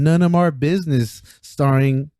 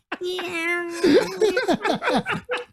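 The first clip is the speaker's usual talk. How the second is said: very high soft squeal; imitated cartoon yawn; 6 dB quieter than usual. Friend mimicking a Tom and Jerry yawn, high squeak. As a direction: mimic a cartoon cat in falsetto. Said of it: "From Tom and Jerry, he's like".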